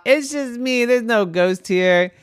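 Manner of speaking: high, loud teasing reveal; prankster brother. A narrator's reading. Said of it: "out popped brother and said".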